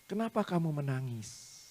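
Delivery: soft, slow, gentle question; careful asking. Retold or imitated. Tutor cautiously asks the crying pupil why, careful and soft. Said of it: "dengan hati-hati saya tanya".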